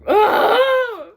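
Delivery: loud, high mock-agonised scream; exasperated scream. Real, not acted. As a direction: cry out in exasperation. Exasperated outburst at thin t-shirt.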